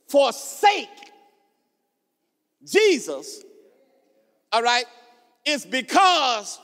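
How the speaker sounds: measured pulpit delivery building to a high shout; rising, driving sermon voice; emphatic preaching. Real, not acted. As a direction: preach phrase by phrase, with long pauses. Preaching at full force.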